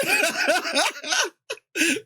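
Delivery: big high-pitched burst of laughter; real high laugh. Real, not acted. Laughs at friend's joke.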